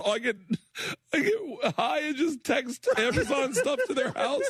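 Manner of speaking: high, giggling delivery; laughing through story. Real, not acted. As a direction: tell it while cracking up. Laughing through daughter gifts story.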